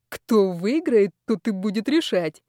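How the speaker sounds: slow, drawn-out menace with a smirk; bloodthirsty. A narrator's reading. Player sets rules for the loser, bloodthirsty. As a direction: relish the threat, stretching the first words. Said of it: "кровожадно ухмыльнулся Колчиновский".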